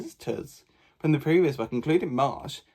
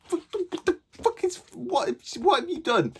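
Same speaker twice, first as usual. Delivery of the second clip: shocked disbelief. Real, not acted. A reader reacting to the book's twist, very high shocked cry. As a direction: gasp it out in horror.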